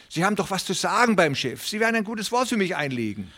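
high, hurried pleading voice of a small worried farmer; anxious little tenant. Acted. Reader voices the tenant Hooven. (aufgeregter kleiner Pächter, hohe, drängende Stimme)

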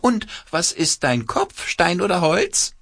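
quacking puppet voice. A narrator's reading. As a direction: squawk it out like a cloth puppet. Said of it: "mit verstellter Stimme quakt er los".

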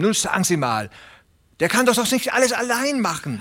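high, fast, fretful little tenant pleading; a nervous little farmhand begging not to lose his place; Hooven the anxious tenant. Acted. Little tenant farmer pleading with Presley, high and anxious.

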